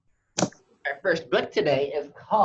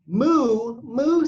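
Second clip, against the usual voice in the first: loud storybook moo, higher on the second; playful, louder cow call; cow's moo; 5 semitones higher, 6 dB louder than usual. A narrator's reading. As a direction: moo like a cow for little kids. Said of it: "said the cow".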